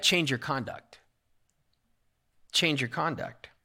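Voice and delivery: ordinary talk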